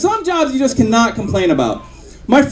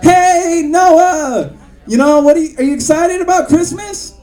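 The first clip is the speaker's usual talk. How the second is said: cheery greeting; 5 semitones higher than usual. Retold or imitated. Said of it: "I'm like".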